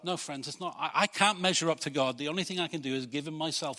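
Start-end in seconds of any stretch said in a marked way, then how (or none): none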